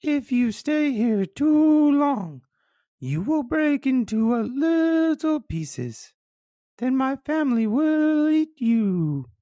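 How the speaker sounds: very high, slow fish voice; high, stretched-out words swooping down; father fish. A narrator's reading. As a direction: warn slowly in a high cartoon fish voice. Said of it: "says an awake father fish".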